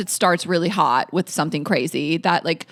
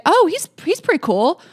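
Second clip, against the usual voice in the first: lightly voiced boyfriend, pitched up; mock an easily impressed man in a higher voice; imitated approving boyfriend; 10 semitones higher than usual. Woman warning listeners quotes their man, lightly mimicking his approval. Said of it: "your man is like".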